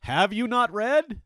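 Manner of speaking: loud, slow, high challenge; emphatic rebuke. Acted. Jesus challenging the Pharisees, loud and slow. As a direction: hit them with it slowly and loudly.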